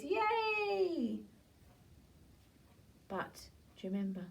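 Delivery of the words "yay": high cheerful yay. Acted. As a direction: cheer for the caught baddies. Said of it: "Even the farmer cheers".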